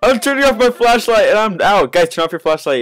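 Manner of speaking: breathless high urgent orders; panicking gamer. Real, not acted. Still fleeing, giving orders.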